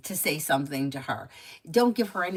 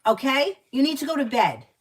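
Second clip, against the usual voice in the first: loud, higher-pitched, firm order; stern parental scolding; 7 semitones higher, 8 dB louder than usual. Real, not acted. Parent ordering a child back to bed, stern. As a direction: lay down the law, louder and higher.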